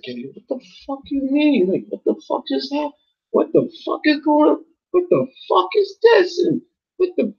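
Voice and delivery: joking, very high falsetto bewilderment; "what the fuck" moment. Acted. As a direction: squeal it as mock disbelief, far above your own voice. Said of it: "we make a joke called the "what the fuck" moment".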